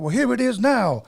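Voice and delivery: high, punchy announcement; imitated record intro. DJ quotes the guy introducing scratching, imitated announcement.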